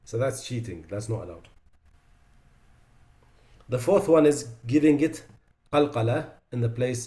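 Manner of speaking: ordinary talk, nothing marked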